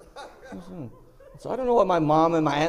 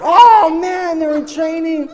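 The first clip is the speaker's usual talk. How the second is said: high, drawn-out comic wail; loud dismay; 12 semitones higher, 13 dB louder, slower than usual. Retold or imitated. Dismayed reaction to trainee masseurs.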